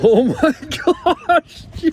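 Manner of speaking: loud, high, laughing; excited laughing shout. Real, not acted. Fish landed in net.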